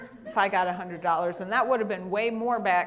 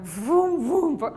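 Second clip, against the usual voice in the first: car noises; 6 semitones higher, 8 dB louder, slower than usual. Retold or imitated. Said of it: "they're like".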